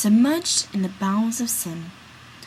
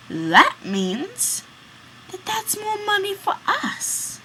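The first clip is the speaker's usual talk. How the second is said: lightly voiced sly conspirator, higher; scheming plotter; 5 semitones higher, slower than usual. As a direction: coax your partner into the plot. Friend proposes murder to his companion.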